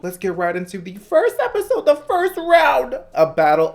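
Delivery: bright, loud presenter energy; high-energy YouTuber host read; upbeat vlog intro. Acted. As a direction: hype up the new series for viewers. Outro call to action.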